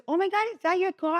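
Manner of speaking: high startled bystander asking; shocked stranger. Retold or imitated. A stranger spotting her crushed car, shocked and high. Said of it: "some guy comes from and says".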